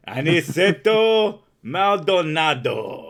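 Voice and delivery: slow, loud, laughing Spanish accent on "Aniceto Maldonado"; sexy Spanish accent. Shown as a demonstration. Trying the guest's name, a slow laughing sexy Spanish accent. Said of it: "weird, sexy Spanish accent".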